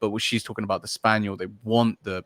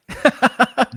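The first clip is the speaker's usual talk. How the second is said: burst of laughter; 7 dB louder than usual. Real, not acted. Reacts to rating the experience.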